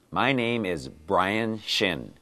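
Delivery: My name is Brian Shin said slowly, word by word; slow it down for learners; slow model line. Shown as a demonstration. Teacher repeating the line slowly so learners catch the B. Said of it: "Today's dialogue has this sound in it".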